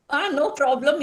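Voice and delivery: ordinary talk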